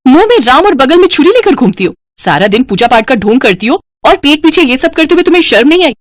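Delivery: high, indignant sister-in-law; heated, high-pitched tirade; scornful accusation. Acted. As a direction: denounce her before the family. Sister-in-law accuses before the family.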